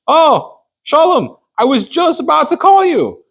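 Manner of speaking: high-pitched, exclaiming Frank at the door; excited greeting. Acted. Frank opens the door to the man about to knock, excited greeting.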